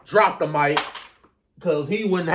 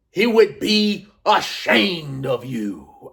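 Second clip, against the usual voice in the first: very slow, deliberate put-down; measured, word-by-word mock promo line; [name]'s delivery; much slower than usual. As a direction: stretch out "ashamed" for dramatic weight. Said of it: "I like [name]'s delivery".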